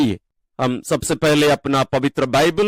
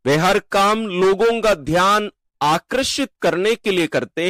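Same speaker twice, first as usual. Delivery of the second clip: higher-pitched, deliberate Gospel proclamation; solemn scripture reading; 4 semitones higher than usual. A narrator's reading. Teacher reading Jesus' words to the disciples, as formal scripture proclamation. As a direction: proclaim it phrase by phrase, lifted pitch, measured pauses. Reads the Gospel passage aloud.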